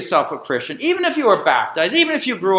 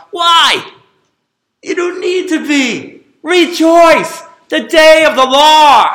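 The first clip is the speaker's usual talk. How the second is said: loud, rising pulpit cry, higher and louder throughout; preaching at full force; 5 semitones higher, 7 dB louder, slower than usual. Acted. Preacher exhorting the church to take their brakes off, full-force shouted preaching. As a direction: urge the congregation to rejoice at full voice.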